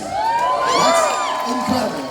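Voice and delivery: huge high, slow shout of delight; excited shout. Real, not acted. Host thrilled that the guest voices Sephiroth, excited shout. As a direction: gush like a fan who just learned it.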